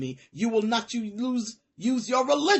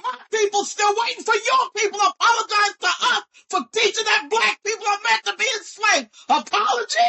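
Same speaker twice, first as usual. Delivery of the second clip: much higher and louder, yelled; furious shout; 10 semitones higher, 7 dB louder than usual. Real, not acted. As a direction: pour out the outrage at full volume. Yelling about slavery teaching.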